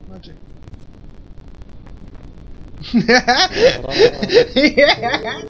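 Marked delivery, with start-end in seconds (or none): 0.4-4.5 high breathy giggle fits; giggling in hiccupping bursts; hiccup giggles. Real, not acted. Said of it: "Stop making me laugh".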